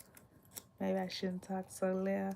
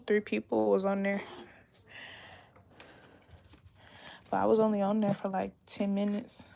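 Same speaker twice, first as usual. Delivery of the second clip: louder, animated chatting; louder lively chat; 7 dB louder than usual. Real, not acted. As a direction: talk up, full voice now. Talking much louder now.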